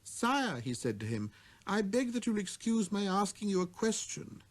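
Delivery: put-on high, wondering child's voice; little prince. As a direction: puzzle it out like a small boy.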